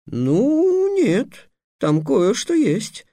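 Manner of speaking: high, shaky, slow mumble with a whimper; mumbling nervously. A narrator's reading. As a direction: stammer it out, caught unprepared. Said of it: "промямлил Трикс". (нерешительно мямля, высоким голосом)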